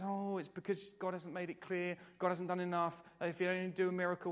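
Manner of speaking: high-pitched mimicked complaining; mock excuses. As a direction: mimic someone making feeble excuses. Mimics people making excuses.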